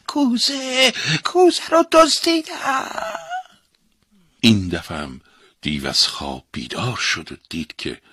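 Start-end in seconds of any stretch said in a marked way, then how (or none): 0.1-3.0 very high, strange squeaky alarm voice, slow; a tiny enchanted object sounding the alarm; magic object alarm. A narrator's reading. Talking golden object betrays the thief in a shrill alarm cry. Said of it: "با صدای بلند اعلام کرد".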